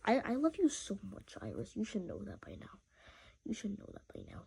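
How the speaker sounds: very soft, low loving murmur; tender murmur. Real, not acted. Telling his cat he loves her, very soft and tender.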